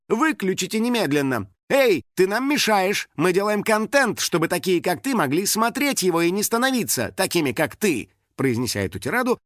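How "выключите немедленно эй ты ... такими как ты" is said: high-pitched indignant tirade. A narrator's reading. Star berating boy on moped, indignant. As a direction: scold the intruder, voice pitched up. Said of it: "возмутилась Лиза".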